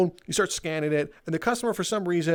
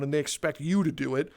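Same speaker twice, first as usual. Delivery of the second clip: ordinary talk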